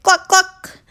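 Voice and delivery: high squeaky hen clucks; high playful clucking; pig clucking like a hen. Acted. Pig giving back clucks.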